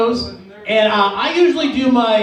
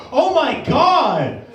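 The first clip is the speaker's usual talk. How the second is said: drawn-out, higher exclamation of dismay; mock shock; 5 semitones higher, much slower than usual. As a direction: stretch it out, slow and appalled. His retold shock at being accused.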